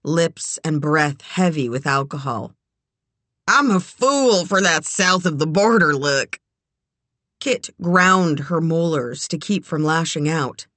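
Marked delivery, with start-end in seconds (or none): 3.5-6.4 lightly voiced boorish drunk; drunk man voice. A narrator's reading. Larry voiced.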